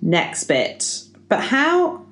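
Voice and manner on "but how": higher, theatrical birthday speech delivery; speech reading. Acted. Podcaster reading out a birthday speech, performed oratory. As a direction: deliver it to the room as a rousing speech.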